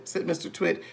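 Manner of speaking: plain reading voice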